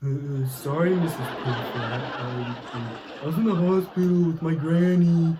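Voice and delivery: stammering, giggly fake excuse; lame excuse. [name] making excuses to professor, stammering laughing. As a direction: fumble a silly lie. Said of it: "such as".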